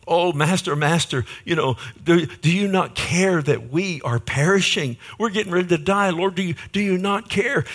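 high-pitched, urgent imitation of desperate disciples; panicked plea. Disciples waking Jesus in the storm, panicked plea. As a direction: plead for rescue, pitch climbing.

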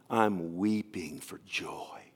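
slow, breathy whisper through tears; a soft weeping murmur; tearful whisper. Retold or imitated. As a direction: let the words barely come out, overcome with emotion. Crying man tells pastor his joy.

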